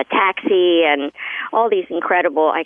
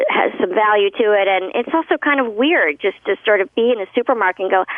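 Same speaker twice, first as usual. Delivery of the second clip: usual speaking voice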